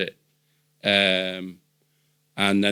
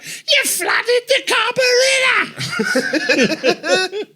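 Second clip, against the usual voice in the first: hammed-up shriek, louder than talk; imitated street shout; 6 dB louder than usual. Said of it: "a bloke in the middle of the street shouting".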